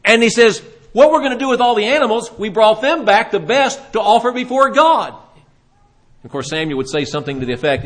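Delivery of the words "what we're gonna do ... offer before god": higher-pitched excuse; acted, hurried justification; self-justifying. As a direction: plead your case as if caught out. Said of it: "he starts trying to justify".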